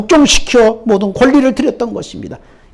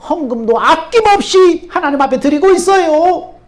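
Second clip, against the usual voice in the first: eager insisting shout; 7 semitones higher, 5 dB louder than usual. Acted. Traveler insists he believes.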